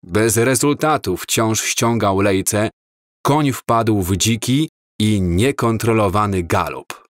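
usual speaking voice

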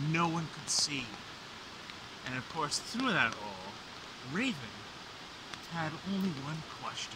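dramatic legend narration; myth teller. A narrator's reading. Myth resumes after drawing talk.

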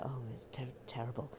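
low, soft disapproving murmur; hushed aside. Pretend. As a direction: slip it in under the report. Hushed aside during mock report.

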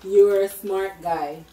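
very slow, clearly mouthed words; slow, exaggerated mouthing. Real, not acted. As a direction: stretch every word for lip reading. Repeating slowly for lip reading.

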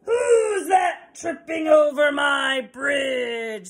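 slow, loud shouting troll; mean old troll. Acted. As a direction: roar it out slowly like a troll. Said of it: "said the mean old troll".

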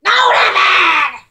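shouting. Pretend. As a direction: shout to stop him, stretching it out. Calls out to stop David.

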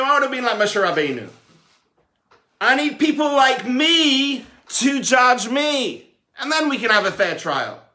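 loud, high, worked-up objection to the angels; indignant protest. Acted. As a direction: argue your case hard, voice up and pushing. Man rejecting the prophets as judges.

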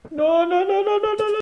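high panicked no's. Real, not acted. Panic as neighbour comes.

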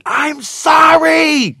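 put-on long, high, loud angry child's scream. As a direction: scream an apology in a rage through a shut door. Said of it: "screaming ... you know, like, angrily".

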